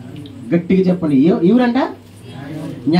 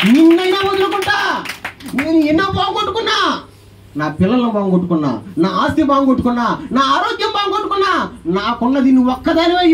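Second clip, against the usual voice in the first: high, shouted cries of loss and loyalty; preacher crying out as Job, refusing to let go; impassioned vow; 6 semitones higher than usual. Acted. Preacher voices Job's vow.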